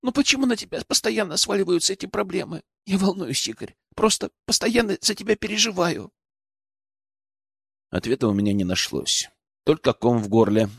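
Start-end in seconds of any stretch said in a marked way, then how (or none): 0.0-6.1 high, soft, anxious voice; worried pleading woman. A narrator's reading. Алена tells Игорь she worries for him, pleading. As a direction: fret softly, pitched well up.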